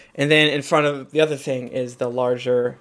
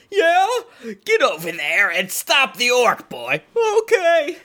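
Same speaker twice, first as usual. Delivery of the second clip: squeaky guard and boss; 10 semitones higher than usual. Acted. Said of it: "he says".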